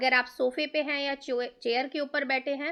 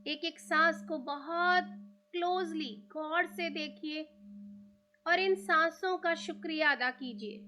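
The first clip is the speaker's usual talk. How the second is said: guided meditation. Real, not acted. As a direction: ease them into stillness, leaving room to breathe.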